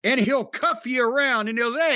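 ordinary talk, nothing marked

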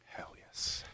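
excited whisper. Real, not acted. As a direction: breathe it out, hushed and eager. Hushed eager reply to main event.

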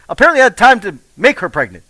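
normal talking voice

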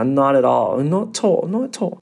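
hammed-up polite English repeat; playful British politeness; mock British accent. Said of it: "这样喜欢回答".

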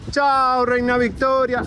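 high, sung-out goodbye; farewell call. Shown as a demonstration. Reporter showing fans how to say goodbye, calling out. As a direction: call the goodbye across the street. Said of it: "ahora sí despedimos con así".

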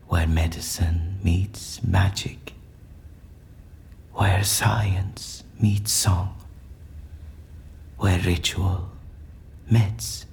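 slow, hushed verse, half whispered; mystical recitation. Acted. Prisoner lures the agents with whispered, rhyming promises. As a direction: lure them in like a spell.

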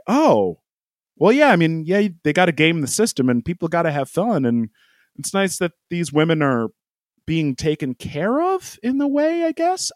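high, sing-song mock voice rising into a squeak; mock naive approval. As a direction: parody someone brushing it off cheerfully. Mocking people who justify it.

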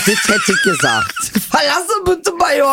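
squealing high through laughter; laughing verdict. Real, not acted. Joking about buzzing out her friend, laughing high. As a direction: giggle out the verdict.

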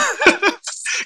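sudden shrill laugh; high laugh burst. Real, not acted.

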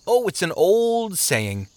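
slow, high, lazy brush-off; dismissive. A narrator's reading. Sluggard waving away the traveler's question, dismissive. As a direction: drawl it as not worth explaining. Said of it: "waved a hand dismissively".

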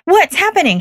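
high-pitched retold alarm; alarmed teacher. Teacher finding students freaking out, imitated alarm. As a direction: imitate a startled demand for answers. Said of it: "she's just like".

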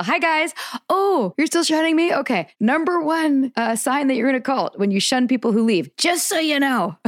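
high-pitched, singsong pretend lecture; mock-cheery taunt. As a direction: greet the shunners, then pointedly school them. Imagines taunting shunners at trial.

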